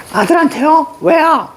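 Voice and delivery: very high, incredulous questions; acted high-pitched bafflement; startled questioning. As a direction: ask it high, taken aback. Voices mother's startled questions.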